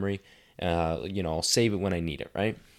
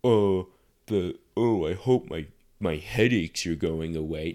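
mock fumbling excuse to the coach; fumbling idiot voice; 5 dB louder, slower than usual. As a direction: stammer it out like a fool. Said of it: "I sound like a fucking idiot saying".

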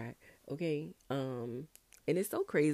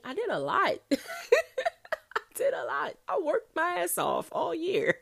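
very high, loud, laughing squeal; laughing exclamation; 9 dB louder, slower than usual. Real, not acted. Host looking back on a busy year, laughing high and loud. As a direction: shout it through real laughter, voice shooting up.

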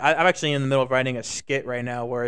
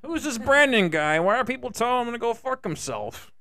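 higher put-on confused politician voice; mock baffled president; 7 semitones higher than usual. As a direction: puzzle aloud as a befuddled president. Impersonates president baffled by chant.

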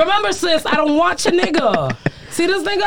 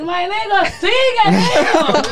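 high, loud and very slow through laughter; egging him on while cracking up; laughing encouragement; 6 semitones higher, 4 dB louder, slower than usual. Retold or imitated. Retelling urging him on during sex, laughing slow shout. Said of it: "And I'm like".

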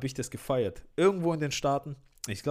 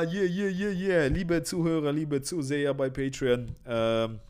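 normal talking voice

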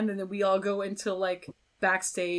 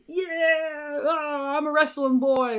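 hyped wrestler; 7 semitones higher, 3 dB louder, slower than usual. Retold or imitated. Wrestler entrance mimicked.

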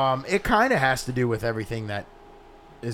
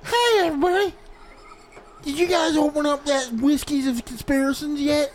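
high-pitched put-on visitor voice; Kenny; 12 semitones higher than usual. Co-host playing the friend arriving for the whiskey, high-pitched. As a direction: call in high, like an eager latecomer. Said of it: "That's gonna be Kenny".

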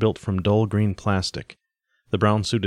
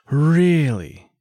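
incredulous high "Really?"; a startled, higher question; mock surprise, raised pitch and louder; surprised; 8 semitones higher, 5 dB louder than usual. A narrator's reading. Woman surprised by several geometries.

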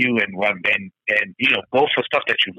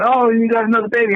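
put-on high teasing jab about another baby; mocking teasing; 8 semitones higher, 3 dB louder than usual. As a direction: poke fun at him. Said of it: "People make fun".

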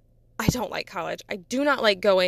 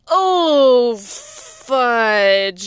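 mock dismay; 6 dB louder than usual. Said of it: "I was like".

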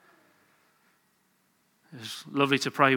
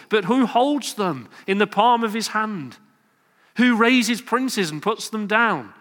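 preaching at full force; 5 semitones higher than usual. Acted. Rising sermon climax.

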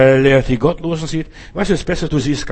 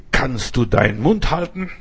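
usual speaking voice

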